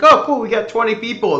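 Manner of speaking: high and quick, pleased little cheer; quick, upbeat mock excitement; excited thought. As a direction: voice your delighted inner thought. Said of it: "I was like".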